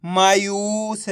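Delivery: मायूस as one slow, joined word; blended word. Shown as a demonstration. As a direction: stretch मायूस without pauses. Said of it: "तो बताइए मिलके क्या बनेगा?". (मायूस को मिलाकर धीरे से)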